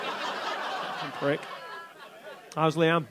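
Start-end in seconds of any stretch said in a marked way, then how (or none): none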